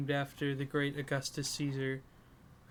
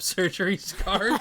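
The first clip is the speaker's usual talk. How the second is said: laughing; 4 semitones higher, 6 dB louder than usual. Real, not acted. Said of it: "somebody captioned it".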